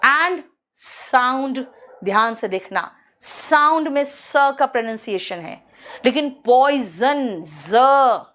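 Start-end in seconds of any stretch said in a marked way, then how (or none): 6.1-8.2 पॉइजन said very slowly with a buzzing ज; drawn-out poison with z; slow z demo. Shown as a demonstration. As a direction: stretch the ज in पॉइजन. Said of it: "ज निकल रहा है".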